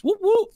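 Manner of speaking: high siren whoop; siren imitation. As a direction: mimic a police siren going whoop whoop. Police lights flash behind them.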